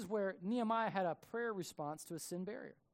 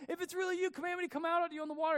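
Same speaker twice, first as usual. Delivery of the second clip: high, quick eager plea; high-pitched hurried request; eager Peter; 11 semitones higher, faster than usual. Retold or imitated. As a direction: beg to be called out, excited. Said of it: "Peter's like".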